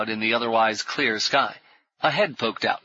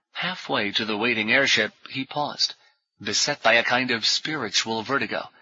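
ordinary delivery, nothing marked

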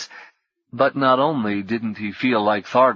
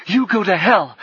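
high, loud defiant shout; loud, high defiant yell; 10 semitones higher, 5 dB louder than usual. Acted. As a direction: spit it at him like a warrior princess.

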